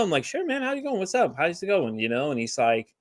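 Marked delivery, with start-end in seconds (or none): 0.2-2.0 high, chirpy, soft friendly hello; bright, casual, pitched way up; breezy greeting. Retold or imitated. Greeting a standoffish customer at the counter, high and breezy.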